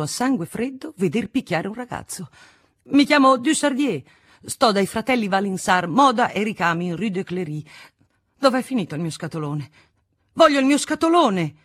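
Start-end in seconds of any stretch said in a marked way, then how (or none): 2.9-11.5 raised, insistent declaration, peaking high and loud; performed agitated outcry; indignant protest. A narrator's reading. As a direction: protest to the guards, demanding your property. Arrested errand boy protests loudly.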